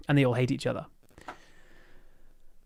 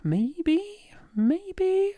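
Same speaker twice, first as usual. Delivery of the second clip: playful high maybe. Real, not acted. Teasing uncertain musing.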